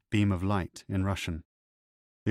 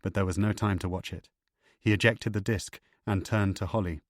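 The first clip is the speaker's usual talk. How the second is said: ordinary delivery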